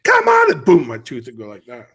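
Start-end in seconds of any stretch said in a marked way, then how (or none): 0.0-0.5 sudden high, loud coaching shout; coach's yell. Retold or imitated. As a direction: bark it from the sideline like a coach. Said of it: "every time I was coaching".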